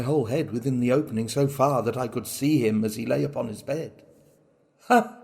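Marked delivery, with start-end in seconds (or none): 4.9-5.2 a sharp, high burst of a mad laugh; triumphant mad laugh. A narrator's reading. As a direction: bark out one gloating laugh. Madman's triumphant outburst.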